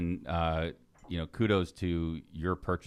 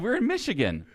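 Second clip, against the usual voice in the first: high exasperated retort; 13 semitones higher than usual. Retold or imitated. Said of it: "I said".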